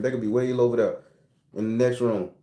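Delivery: ordinary talk, nothing marked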